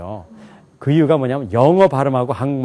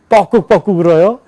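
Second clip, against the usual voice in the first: mock cuckoo call; 4 semitones higher, 5 dB louder than usual. Shown as a demonstration. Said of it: "뻐꾸기가 어떻게 우나요?".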